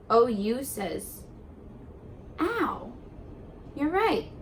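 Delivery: usual speaking voice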